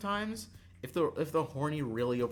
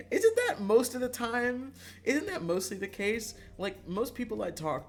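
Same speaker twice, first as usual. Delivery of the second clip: high, loud, through laughter; laughing while talking; 11 semitones higher, 6 dB louder than usual. Real, not acted. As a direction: get the question out while laughing.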